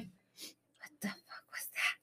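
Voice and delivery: slow, barely audible whisper; retold hushed fright; spooked whisper. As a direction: whisper it slowly as if listening out. Retold scare at a strange noise.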